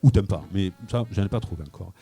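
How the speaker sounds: ordinary talk, nothing marked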